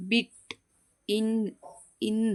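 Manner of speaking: ಬಿಟ್, ಇನ್ each said alone with a short "i"; short i demonstration. Shown as a demonstration. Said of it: "ಈ ಅಂತ pronunciation ಮಾಡ್ತೀವಿ".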